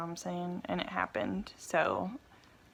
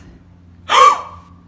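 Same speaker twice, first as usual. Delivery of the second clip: sharp, loud gasp; sudden high gasp of shock; 12 dB louder than usual. Real, not acted. Woman seeing her pregnancy test result, shocked gasp.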